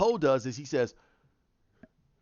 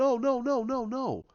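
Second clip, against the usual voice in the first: high, rapid run of refusals; mock panic, pitched well up; retold alarmed denial; 10 semitones higher than usual. Characters turn around and refuse to believe it, retold alarm.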